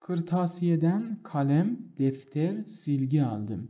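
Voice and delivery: very slow, word by word, pausing at each comma; slow dictation. Shown as a demonstration. Teacher to students learning commas, slow dictation. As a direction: spell the list out slowly for the class. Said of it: "ببینید، بین اینها از کاما".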